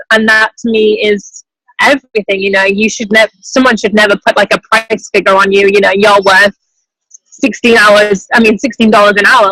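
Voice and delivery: ordinary delivery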